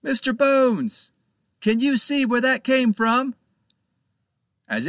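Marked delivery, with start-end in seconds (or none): 0.0-3.4 captain calling up to the crow's nest. A narrator's reading. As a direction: call up to the lookout. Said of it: "He called up to the crow's nest".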